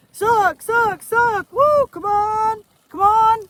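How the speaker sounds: high, very loud holler with a drawn-out end; dad's cattle call. Shown as a demonstration. Wife repeats her dad's cattle call, hollered high. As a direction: call the cows in from all over the place. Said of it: "my dad always said".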